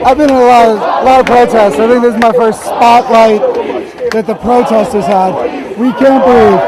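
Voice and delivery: talking loud over a crowd; loud over the chanting crowd. Real, not acted. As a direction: project over the noise of the protest. Speaking over the protest crowd.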